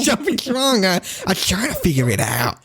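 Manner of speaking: high, pleading; mock distressed voice. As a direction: whine it helplessly. Echoing the mock distressed caller.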